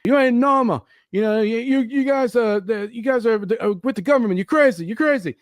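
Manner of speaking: high-pitched agitated accuser; paranoid man. Retold or imitated. Voices paranoid man behind the door.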